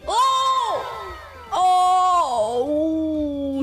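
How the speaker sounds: excited gasps. Real, not acted. Sees a pretty character.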